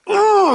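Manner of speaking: high mock "ooh" of pain; squeezed-in-pain ooh. Host acting out a big squeeze, mock pained. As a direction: falsetto yelp, hammed up. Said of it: "gave you a big squeeze".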